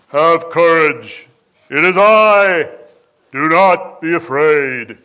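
loud, slow, booming movie-epic voice; sonorous Charlton Heston Jesus. Shown as a demonstration. Preacher mocking a solemn film Jesus, loud and slow. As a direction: boom it out like an old Hollywood Moses. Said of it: "wasn't the sonorous Charlton Heston".

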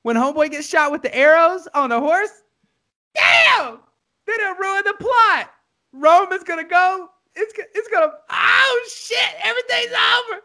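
very high-pitched, hammed-up crying voice; mock tearful wailing. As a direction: wail it out like an overwrought movie fan. Acting out his tearful movie reaction.

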